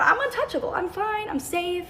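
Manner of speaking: bubbling over with relief and glee; giddy relief. Retold or imitated. Feeling safe with her group.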